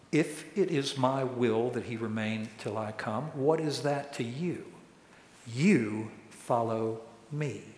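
soft, measured scripture reading, weighting the last words; measured Jesus quote. Retold or imitated. Jesus answers Peter's question about John, quiet and deliberate. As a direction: let it land quietly, slowing right down at the end. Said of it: "And Jesus said to him".

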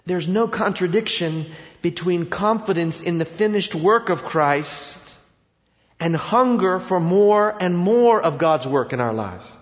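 normal talking voice

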